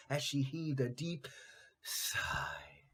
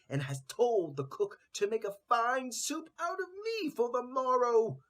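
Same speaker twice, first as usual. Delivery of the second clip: loud, higher, frantic crowing lament; desperate rooster cry; 6 semitones higher, 8 dB louder than usual. Acted. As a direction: crow it out at full throat in despair. Rooster crows out his doom.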